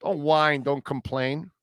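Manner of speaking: normal talking voice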